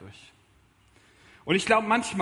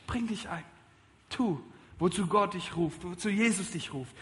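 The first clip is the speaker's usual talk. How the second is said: normal talking voice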